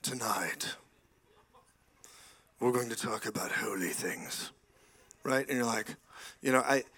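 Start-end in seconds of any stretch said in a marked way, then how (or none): 0.0-4.5 hushed, whispered holy-preacher parody; mock solemn preacher.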